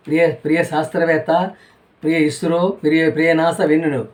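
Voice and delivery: normal talking voice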